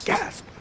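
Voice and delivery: high, sharp theatrical gasp; mock gasp. [name] hears the false accusation, mock shocked gasp. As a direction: gasp in shock, then say the word.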